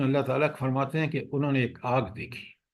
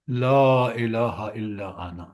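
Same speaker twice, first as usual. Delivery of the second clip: slow, measured Arabic recitation; Quranic recitation; 4 semitones lower, much slower than usual. Shown as a demonstration. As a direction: recite the verse slowly. Reciting Quran verse in lesson. (آہستہ قرآنی تلاوت)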